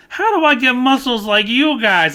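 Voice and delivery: high, loud imitation of the child actor; eager kid pleading; little kid voice. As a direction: ask it like an awestruck boy. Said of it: "he just goes".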